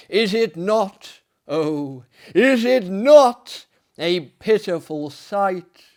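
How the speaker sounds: slow, loud, wailing ghost voice; ghostly wail. Acted. The voice from the graves to the narrator, slow loud ghostly wail. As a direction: cry it out like a lost soul from the grave.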